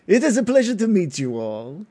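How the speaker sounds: a polished noble greeting the party. Acted. GM voices noble Gindar.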